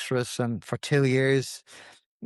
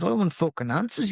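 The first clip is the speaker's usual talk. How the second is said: higher, mildly heated imitation; retold angry demand; 7 semitones higher than usual. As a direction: demand it as a man losing his head, understated. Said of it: "He lost the head".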